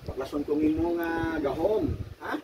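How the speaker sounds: normal talking voice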